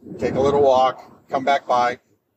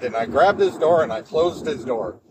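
ordinary talk, nothing marked

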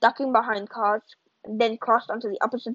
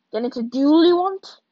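challenging; 5 semitones higher, 4 dB louder than usual. Acted. Amos challenges father.

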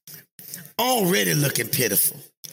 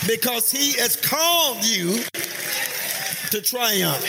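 higher, half-sung preacher's cadence; preaching; 6 semitones higher than usual. Acted. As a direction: build the promise up to triumph. Pastor rising again to preach.

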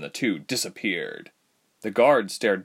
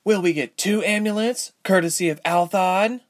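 high, mocking sneer; 11 semitones higher, 3 dB louder than usual. A narrator's reading. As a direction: sneer the question back. Ilse mocking.

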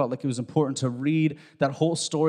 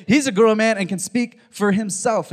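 louder, higher, insistent retort; emphatic parents; 7 semitones higher, 6 dB louder than usual. Acted. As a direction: push it out forcefully, voicing fed-up parents. Parents' line repeated emphatically.

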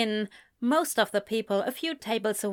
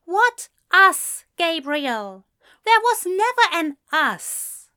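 high, loud rejection; indignant denial; 9 semitones higher, 7 dB louder than usual. A narrator's reading. Man denies any romance with friend, indignant. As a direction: shut him down, loud and high. Ezra rejects Gabriel in a quarrel.

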